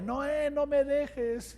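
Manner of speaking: high, slow whimpering plea, half mock; tearful plea. Dragged off, begging cameraman not to leave, high whimpering plea. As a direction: whine it like a scared kid about to cry. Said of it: "se me empezaban a hacer mis lagrimitas".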